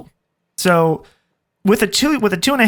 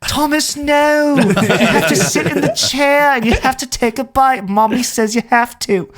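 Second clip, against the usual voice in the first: higher-pitched, drawn-out scolding nanny voice; Super Nanny; 5 semitones higher, slower than usual. Retold or imitated. Parent retelling clips watched for motivation, mock stern nanny. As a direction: lay down the law like a strict TV nanny. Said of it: "looking up clips of Super Nanny".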